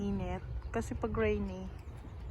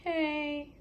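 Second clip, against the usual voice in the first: soothing a pet; 2 semitones higher, slower than usual. Real, not acted. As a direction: lull her toward sleep. Soothing tired Molly to sleep.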